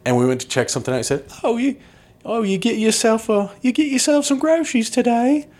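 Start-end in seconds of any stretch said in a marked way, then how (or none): 1.4-5.5 mock English accent. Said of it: "Having a bubble".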